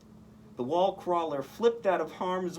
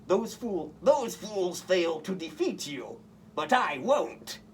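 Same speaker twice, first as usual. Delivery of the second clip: snarling Goblin; 4 semitones higher than usual. A narrator's reading. Green Goblin threatening Spider-Man, mild villain snarl. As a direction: growl the threat like a cartoon villain. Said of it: "snarled the Green Goblin".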